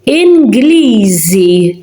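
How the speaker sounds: Inglisi very slowly with a buzzing z; slow z demo. Shown as a demonstration. Teacher slowly modelling Inglisi with z. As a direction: draw out Inglisi, stressing the z. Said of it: "You're hearing the word Z".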